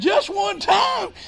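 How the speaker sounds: high, slow, shouted disbelief; astonished echo. Retold or imitated. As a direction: throw the words back in amazement. Echoing the preacher in disbelief.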